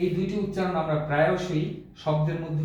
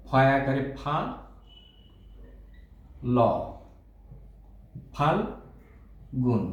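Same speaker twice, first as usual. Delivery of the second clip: slow, broken into parts; ফা, ল, then Phalgun, each piece spaced out slowly; syllable by syllable; 3 semitones lower, much slower than usual. Shown as a demonstration. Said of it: "ভেঙে ভেঙে উচ্চারণ করতে যাই". (ভেঙে ভেঙে ধীরে উচ্চারণ)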